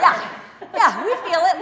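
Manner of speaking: breathless high laughing words. Real, not acted. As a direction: laugh through the pain. Laughing through the burn.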